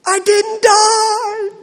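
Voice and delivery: put-on high, loud, slow child's exclamation; drawn out like a thrilled kid; amazed little kid. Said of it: "child relieved after ride".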